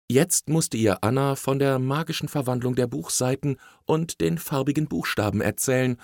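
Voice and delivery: usual speaking voice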